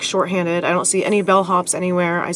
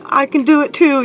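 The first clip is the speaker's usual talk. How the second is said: mock angry retort; 6 semitones higher, 5 dB louder than usual. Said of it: "[name]'s losing his cool".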